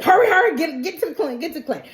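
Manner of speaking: acted urgency. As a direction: urge them to rush. Acting out urging others to clinic.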